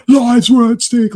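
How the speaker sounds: high, loud, slow outburst; hammed-up tantrum, drawing the words out; mock losing temper. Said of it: "he starts losing his temper".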